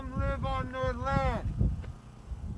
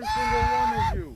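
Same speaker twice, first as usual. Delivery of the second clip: pitched way up and louder; high shout; 5 dB louder than usual. Real, not acted. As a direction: yell it high at them. Repeated louder at officers.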